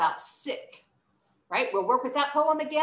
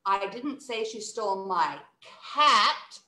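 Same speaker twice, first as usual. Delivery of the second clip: CAT louder and stronger; pause, then punch "cat"; stress on CAT. Shown as a demonstration. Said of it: "lean into that last word and say it louder".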